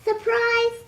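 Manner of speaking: high, loud gleeful cry; cried surprise. A narrator's reading. Wolf surprising Granny again, high gleeful cry. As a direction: jump out with it.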